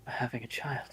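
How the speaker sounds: a whispered, awed phrase; stunned whisper. Acted. George taking in that Maria is pregnant, awed whisper. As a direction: breathe it out in disbelief.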